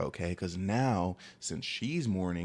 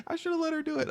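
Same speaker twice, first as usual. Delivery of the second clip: whiny regret. Real, not acted. Regretful aside, very high.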